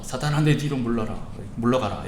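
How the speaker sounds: ordinary talk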